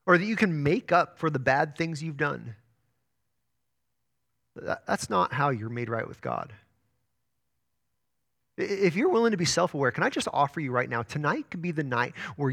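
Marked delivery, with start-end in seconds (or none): none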